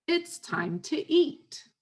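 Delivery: high, drawn-out "time to EAT"; slow, stressed key words. Shown as a demonstration. Parent calling a young child to dinner, slow high emphasis. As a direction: stretch "It's time to eat" slowly, lifting high for a child. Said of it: "emphasizing some keywords there".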